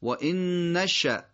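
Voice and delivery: نشأ ending in a sudden glottal stop; final still hamza. Shown as a demonstration. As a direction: say و ان نشأ slowly, stopping hard on the hamza. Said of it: "همزمون در آخر ساکنه". (و ان نشأ شمرده، با همزهٔ ساکن در آخر)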